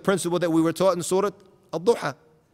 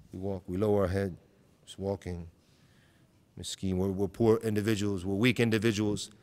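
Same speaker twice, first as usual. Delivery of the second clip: much softer, lower, mumbling like a pitiful poor man; mock meek humility; 8 semitones lower, 7 dB quieter than usual. Preacher mocks Muslims who walk as weaklings, softly. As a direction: play the self-pitying miskeen, small and muted. Mocking a falsely humble walk.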